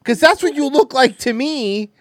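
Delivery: high, loud heckle; mock incredulous retort. Real, not acted. Host talking back to the woman in the clip, loud incredulous mockery. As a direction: challenge it loudly, pitched right up. Mocking retort to clip's opinion.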